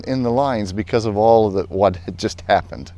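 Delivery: ordinary delivery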